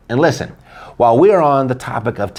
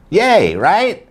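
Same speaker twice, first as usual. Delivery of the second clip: sarcastic; 8 semitones higher than usual. Acted. Sarcastic fake cheer about rates.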